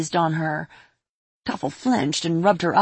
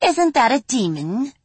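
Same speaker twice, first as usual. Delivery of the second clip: fairy voice; 7 semitones higher than usual. Acted. Fairy voice in read story.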